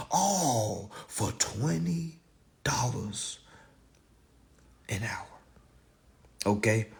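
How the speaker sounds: slow, hushed whisper, dropping away. Acted. Comic to the crowd, slowing to a whisper. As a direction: let the last words die to a whisper. Punchline on low hourly pay.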